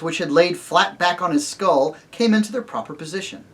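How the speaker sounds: usual speaking voice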